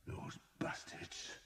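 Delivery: slow, soft bitter whisper; vengeful whisper. A narrator's reading. Skeet cursing the newcomers, a slow vengeful whisper. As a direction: hiss it with resentment. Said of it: "he said vengefully".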